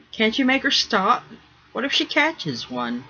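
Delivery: agitated. Acted. Boy begging his father to save the mermaids, agitated. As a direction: beg him, anxious. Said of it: "grow agitated".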